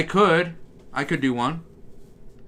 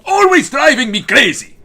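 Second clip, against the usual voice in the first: high, loud exasperated cry in a cartoon accent; loud, dragged-out Dracula-style complaint; Grim, Dracula-like; 10 semitones higher, 8 dB louder than usual. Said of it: "that's really more Dracula".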